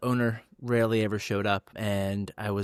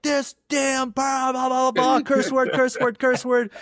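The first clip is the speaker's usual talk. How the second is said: much higher, slow then louder hammed-up tirade; mock cursing rant; 13 semitones higher, 4 dB louder, slower than usual. After hanging up on the boss, high mock cursing rant. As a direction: playfully imitate the angry cursing fit. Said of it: "I just started cursing".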